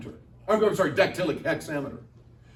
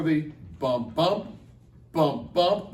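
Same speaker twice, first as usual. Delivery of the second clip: bump, bump, bump, bump in a da-DUM beat with the second "bump" of each pair stressed; iambic bump rhythm; much slower than usual. Shown as a demonstration. Teacher shows students the iambic rhythm, sounding out a da-DUM beat. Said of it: "That's the iambic rhythm".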